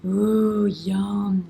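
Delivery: ordinary delivery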